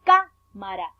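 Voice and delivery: "Cámara" with a loud, separated CÁ; stress on CÁ. Shown as a demonstration. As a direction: punch "Cá", then let "mara" follow. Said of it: "Allí está la sílaba tónica". ("Cámara" marcando fuerte la sílaba "Cá")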